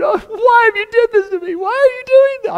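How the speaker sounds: high, loud tearful wail; crying mother. Retold or imitated. Mary scolding her lost son, high loud tearful wail. Mary distraught at finding Jesus.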